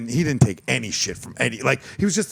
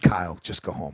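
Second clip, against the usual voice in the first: lightly voiced stern dad; a hint of a fed-up father; 8 semitones lower than usual. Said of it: "I became my father".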